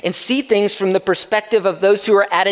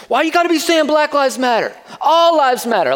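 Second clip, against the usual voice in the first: higher, louder imitation of a belligerent drunk guy; drunk heckler voice; 11 semitones higher, 4 dB louder than usual. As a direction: mimic the heckler getting in their face. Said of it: "I don't know why he's talking like that".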